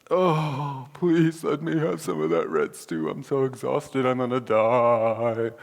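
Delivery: whiny, overdone dying plea, slow at the end; dramatic Esau. Retold or imitated. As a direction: ham up a starving brother begging. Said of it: "Esau is being dramatic".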